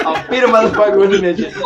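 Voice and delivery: laughing it out loud; cracking up while repeating the joke. Real, not acted.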